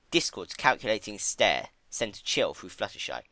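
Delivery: ordinary delivery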